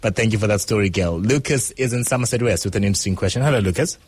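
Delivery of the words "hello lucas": normal talking voice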